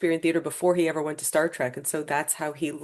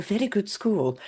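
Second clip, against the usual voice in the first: put-on touch of British school accent, higher; slightly raised, British-sounding imitation; 5 semitones higher than usual. Imitating neighbor's British-schooled speech.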